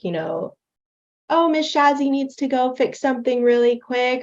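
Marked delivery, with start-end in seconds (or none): 1.3-4.2 high, sing-song teacher to class; covering teacher. Retold or imitated. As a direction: brightly cover for a hiccup, pitched up. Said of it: "they'll totally swing it and be like".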